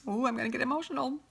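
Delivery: high, soft voice on the verge of real tears; choked up. Real, not acted. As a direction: fight back tears while trying to keep talking. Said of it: "I'm so sorry I'm getting emotional".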